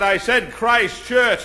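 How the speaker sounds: slow, loud, insistent correction; an exasperated bishop repeating himself very slowly; annoyed bishop. Retold or imitated. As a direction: spell it out to him, irritated. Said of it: "And the bishop got mad".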